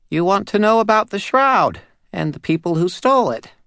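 high, tiny, eerie doll voice; a small uncanny doll spirit speaking. Acted. Doll spirit speaks while chewing.

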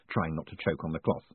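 plain reading voice

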